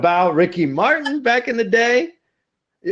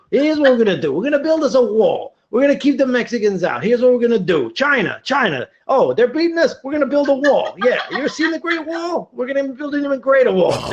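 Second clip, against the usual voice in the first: higher, pushy blustering politician voice; bad Donald Trump impression; 7 semitones higher than usual. Mocking Trump's wall promises to his friends, high blustering voice. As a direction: bluster like a braggy candidate. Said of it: "my very bad Donald Trump impression".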